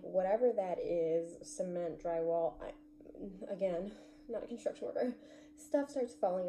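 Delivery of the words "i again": ordinary talk, nothing marked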